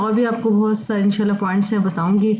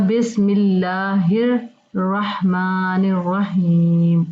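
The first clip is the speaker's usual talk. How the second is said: slow reading; much slower than usual. Shown as a demonstration. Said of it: "आपको नज़र आ रहा होगा".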